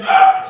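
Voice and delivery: sudden high, loud yelp; short comic scream, way up high; mock startled scream. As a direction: shriek as if just rear-ended. Acts out being rear-ended.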